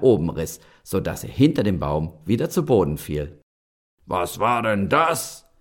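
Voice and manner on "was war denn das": slow rumbling giant voice; growling giant. Acted. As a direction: growl it out slowly. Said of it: "grollte der Riese".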